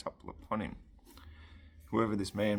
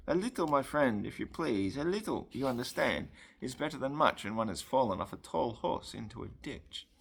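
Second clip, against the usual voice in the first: put-on higher-pitched, courteous French accent; 6 semitones higher than usual. A narrator's reading. Frenchman asking rescuer for a little wine, French accent. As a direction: lift the voice, politely French-accented.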